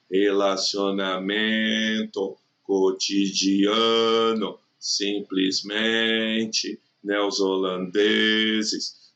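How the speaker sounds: very slow, stretching MEN, A, MEN, DE on a level note; prolonged tonic syllable. Shown as a demonstration. Teacher shows the tonic syllable is lengthened, prolonged stress. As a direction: hold the penultimate syllable long in "Relacionamento, cotidiano, simplesmente, neozelandeses". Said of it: "a sílaba é prolongada".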